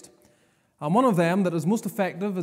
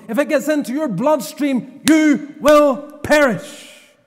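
fiery preaching; 5 semitones higher, 5 dB louder than usual. Acted. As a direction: thunder the warning at the congregation. Fiery preaching of Paul's warning.

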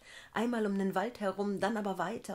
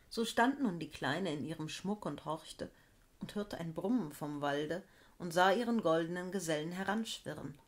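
usual speaking voice

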